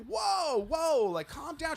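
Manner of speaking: high, drawn-out whoa; soothing mock. Friends calming the screaming lady, high mock soothing. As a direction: placate her in a high voice.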